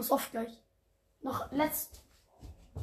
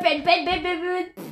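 usual speaking voice

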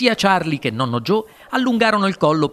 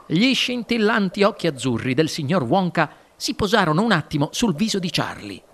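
usual speaking voice

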